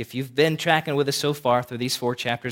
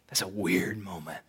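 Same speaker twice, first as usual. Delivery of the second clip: whisper; 8 semitones lower, 9 dB quieter than usual. Real, not acted. Pastor aside after the calm.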